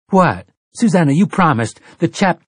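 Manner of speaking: sudden high, loud outburst; startled protest. Acted. Mia forced onto the bench, startled and loud. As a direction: jolt up in alarm as she's pushed down.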